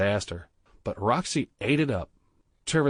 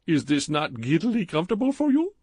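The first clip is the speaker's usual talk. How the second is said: put-on higher, louder, heavy Indian accent; thick Indian accent; 8 semitones higher, 4 dB louder than usual. A narrator's reading. Clerk Badrul flirting with Stephen in the jacuzzi, thick Indian accent.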